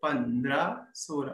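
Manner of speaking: measured count, one number to a beat; low, evenly spaced teaching count; one-count demo. Shown as a demonstration. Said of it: "एक गुण कैसे दिखाते हैं".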